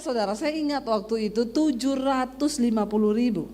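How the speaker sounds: ordinary talk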